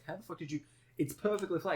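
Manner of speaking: high-pitched, disbelieving retold question; mock incredulous. Imitating friends who saw him trip on flat ground, incredulous. Friends react to his silly injury.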